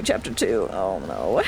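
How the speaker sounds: lage, trage stem voor onheil; deep voice. Shown as a demonstration. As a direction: zeg het diep en dreigend.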